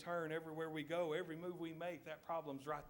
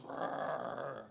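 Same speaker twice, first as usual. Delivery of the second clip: loud rolling lion growl; 3 semitones lower, 7 dB louder than usual. Acted. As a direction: growl loudly like a lion warning someone off. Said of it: "He's gonna growl at him".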